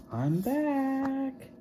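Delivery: high, gentle sing-song return; sweet teacher. Acted. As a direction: announce it sweetly to the kids.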